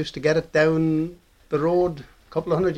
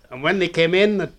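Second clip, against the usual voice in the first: ordinary talk, nothing marked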